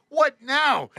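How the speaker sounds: high, loud exclamation; exasperated. Retold or imitated. Retold exasperation at alarm.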